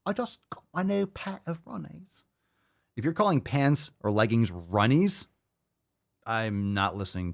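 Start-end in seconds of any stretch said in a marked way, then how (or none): 0.1-2.2 high, soft mock Aussie voice; small, high put-on Aussie twang; mock Australian accent. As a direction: imitate an Australian influencer under your breath, pitched up. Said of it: "some kind of, like, Australian influencer".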